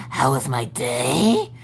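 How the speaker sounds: slow, creaky goblin-like mock cheer. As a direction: snarl it like a grumpy goblin. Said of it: "like a fucking goblin".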